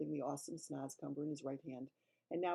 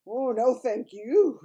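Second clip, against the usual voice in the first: alarmed refusal; 7 semitones higher, 15 dB louder, slower than usual. Acted. Sophie backing away.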